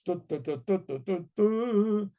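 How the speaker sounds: du du du sung like a bright brass fanfare, rising at the end; mouthed trumpet fanfare. Retold or imitated. Storyteller announcing the king's unveiling, imitating a trumpet fanfare. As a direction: hum the royal trumpets, building up. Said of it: "And the trumpets again, the cornets".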